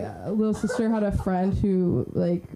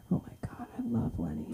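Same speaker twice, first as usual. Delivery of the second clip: imitated dad, whispered adoration; hushed fan gushing; 3 semitones higher, 10 dB quieter, faster than usual. Comedian as dad watching the live stream, hushed gushing. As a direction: gush under your breath, barely voiced. Dad's reaction, acted in hush.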